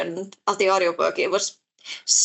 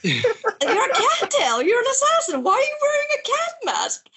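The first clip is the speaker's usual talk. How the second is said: put-on much higher, innocent girl voice; high girlish voice; young girl; 13 semitones higher than usual. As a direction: ask it like a puzzled little girl.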